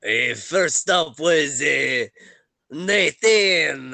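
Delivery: halting put-on French accent. Game master introduces the players one by one, French accent. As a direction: feel for the name in a French accent. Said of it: "am I to continue with it".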